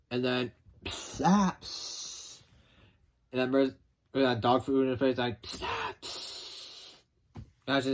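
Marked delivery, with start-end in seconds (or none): 0.8-2.4 spray noise. Retold or imitated. Imitating the dog food spray. 5.4-6.9 spray noise. Retold or imitated. Imitating the spray again.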